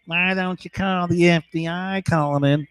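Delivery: put-on taunting voice; joking heckler. Watcher jokes about calling the FBI, mock taunt. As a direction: lightly imitate a heckler's jibe. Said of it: "he keeps joking".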